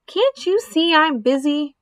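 exasperated, high-pitched protest; disgusted retort. Acted. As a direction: answer back in disgust. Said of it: "replied Avery in disgust".

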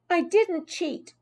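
high, slow, emphatic protest; high-pitched indignant child; insistent denial. A narrator's reading. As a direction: insist you are innocent. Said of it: "insisted Heidi".

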